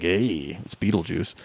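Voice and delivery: low, soft Beetlejuice-ish rasp; a lightly voiced Beetlejuice. The inner voice introducing itself, lightly Beetlejuice. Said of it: "that voice came up like".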